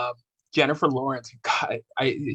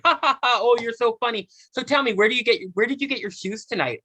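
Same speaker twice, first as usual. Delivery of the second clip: high-pitched mock interviewer with a fake laugh; 7 semitones higher than usual. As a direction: imitate a reporter chirping on past an insult. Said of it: "she's, like, laughing it off".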